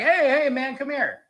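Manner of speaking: high, eager call of hey man; mock hollering. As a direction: call him over like a buddy. Guy calling him over.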